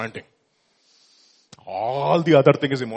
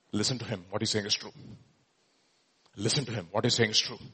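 hushed; 7 semitones lower, 11 dB quieter, faster than usual. Acted. Preacher voices God's quiet backing.

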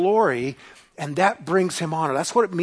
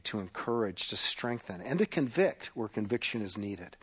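soft, lowered praying voice; quiet prayer; 6 semitones lower, 8 dB quieter than usual. Acted. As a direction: pray humbly and quietly to God. Pastor prays before sermon.

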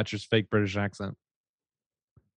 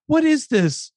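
scoffing disbelief; 13 semitones higher, slower than usual. Real, not acted. Scoffing at a quoted line.

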